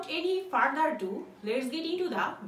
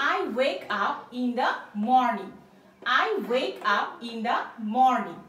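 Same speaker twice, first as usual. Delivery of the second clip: very slow, a bit louder, each word clear; slow model sentence; 2 semitones lower, 4 dB louder, much slower than usual. Shown as a demonstration. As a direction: model it slowly for learners.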